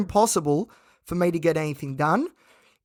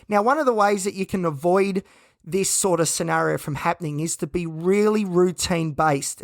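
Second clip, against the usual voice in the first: normal talking voice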